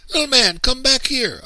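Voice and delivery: loud, very high urgent call. A narrator's reading. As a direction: call your little brother back from afar. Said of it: "I called".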